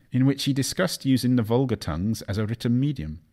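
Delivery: usual speaking voice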